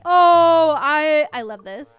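loud burst of love for it; excited outburst. Real, not acted. Gamer thrilled by a decoration, loud excited burst. Loves the item.

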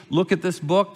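ordinary talk

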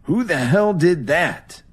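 high, slow, angry snarl; cursing. Acted. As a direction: curse at the intruder. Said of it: "he cursed".

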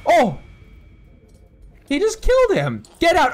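high, loud alarmed shout; startled gamer yelling at the screen; shocked yelling. Real, not acted. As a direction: yell out in sudden shock. Shocked as Pebbles kills Gary.

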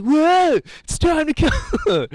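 high, loud hammed-up TV host; Guy Fieri. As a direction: whoop it out like an over-the-top showman. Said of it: "this whole thing going on".

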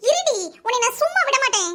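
high-pitched, affronted outburst; outraged threat. Acted. Woman just hit in a quarrel, outraged and vowing payback.

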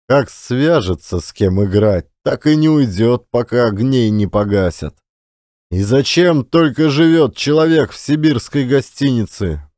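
plain reading voice

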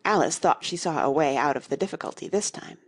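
usual speaking voice

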